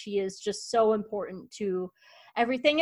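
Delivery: usual speaking voice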